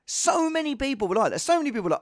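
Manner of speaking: usual speaking voice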